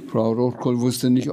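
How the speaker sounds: usual speaking voice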